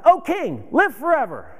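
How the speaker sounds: high, joyful call to the king; joyous call. Retold or imitated. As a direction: call out to the king full of joy. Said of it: "And he yells out".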